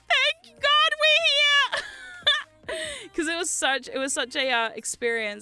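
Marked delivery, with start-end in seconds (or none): 0.1-3.1 very high, very slow, laughing-crying relief; teary relieved cry. Retold or imitated. Landing home in New Zealand, weepy laughing relief. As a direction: wail it out, laughing through tears. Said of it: "I was like".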